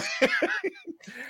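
high wicked cackle; villain laugh. Real, not acted. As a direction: let out a gleeful evil cackle. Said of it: "the villain laugh".

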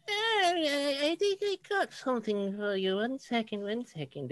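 put-on high, wavering old woman; old lady voice. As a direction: voice a frail, very old shopkeeper. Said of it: "and she's like".

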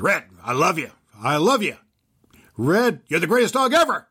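loud, high mock scold. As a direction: scold the dog while praising it. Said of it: "I turned around and I scolded her".

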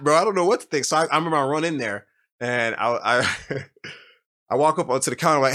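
normal talking voice

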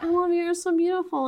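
high, gushing mock drunk; adorable drunk. Drunk husband suddenly adoring his wife, mock gushing. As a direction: gush sloppily like a lovestruck drunk. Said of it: "super drunk and adorable".

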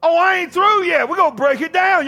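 ordinary talk

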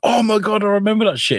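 high-pitched, delighted exclamation; excited recognition. Acted. Fan reminded of something obscure, excited exclamation. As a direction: act out a sudden happy recollection.

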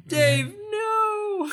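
high, dramatic cry; mock dismay. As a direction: wail in fake grief.

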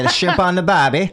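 loud, high mock Australian accent; hammy Australian catchphrase, amused. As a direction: belt it out as a laughing Aussie. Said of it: "let's do an Australian accent".

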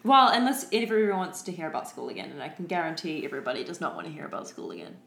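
usual speaking voice

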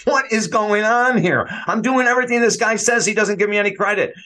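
a put-upon player's bewildered whine, retold for laughs; exasperated Charles.

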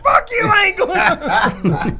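high, slow, laughing protest; hammed-up sulky refusal; mock refusal. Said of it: "I'm like".

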